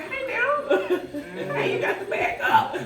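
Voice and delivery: high, laughing mock warning to the old man; laughing shooing. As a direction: shoo him off through laughter. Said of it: "I said".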